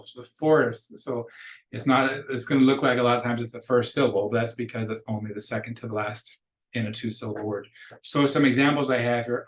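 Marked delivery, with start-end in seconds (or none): none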